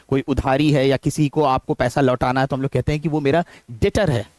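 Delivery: ordinary delivery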